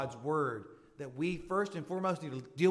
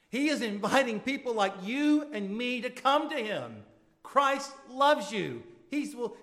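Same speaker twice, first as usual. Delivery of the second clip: impassioned preaching; 7 semitones higher, 7 dB louder than usual. Acted. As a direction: plead with the room at full force. Rising altar call.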